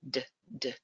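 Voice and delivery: "D, D" as two short separate "duh" sounds; letter-sound demo. Shown as a demonstration. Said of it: "W starts with the D".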